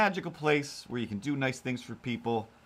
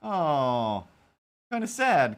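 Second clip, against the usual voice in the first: mock sad; 4 semitones higher, 4 dB louder, much slower than usual. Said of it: "I'm just like".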